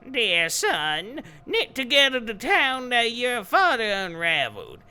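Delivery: loud, high cackling witch declaiming; hag voice. Acted. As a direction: belt it out like a swamp hag. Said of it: "You get a hag voice".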